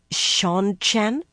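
"Seanchan" sounded out hesitantly with a rise; "Seanchan" set apart, tentative and questioning; awkwardly said name. A narrator's reading. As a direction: say "Seanchan" as an unfamiliar, awkward word. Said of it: "She said the name awkwardly".

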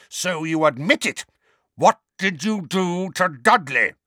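high, loud angry uncle; angry accusation. A narrator's reading. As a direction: thunder the accusation at the boy. Uncle angrily accuses the boy.

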